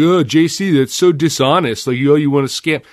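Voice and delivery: hammed-up disapproving whine; mock scolding. Critics scolding the host for dishonesty, mock whiny. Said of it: "everybody can be like".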